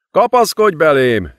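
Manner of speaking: high, strained call for him to hold on; urgent call. A narrator's reading. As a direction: cry out over the battle. Wounded man rescued under fire. (feszült, sürgető kiáltás)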